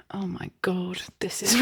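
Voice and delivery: ordinary talk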